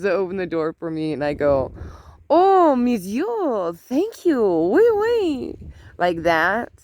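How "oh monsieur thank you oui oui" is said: much higher, slow hammed-up French accent; mock French. Grandma retelling her joking French thank-you to her grandson. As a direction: play a playful French waiter. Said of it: "it was French".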